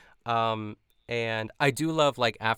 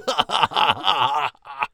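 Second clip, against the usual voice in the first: imitated cackle; 5 semitones higher, 8 dB louder than usual. Mimicking Evie's constant laugh.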